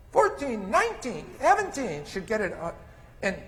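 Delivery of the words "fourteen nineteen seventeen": put-on high childlike counting; small child counting. Daughter counting out of order, high childlike voice. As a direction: count like a little girl getting it wrong. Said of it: "would go".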